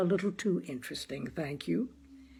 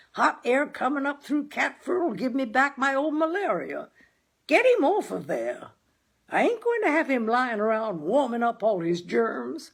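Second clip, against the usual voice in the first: higher, fussy complaining husband; grumbling father; 6 semitones higher, 3 dB louder than usual. Acted. Husband fussing to his wife about the cat, grumbling. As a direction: fuss about germs.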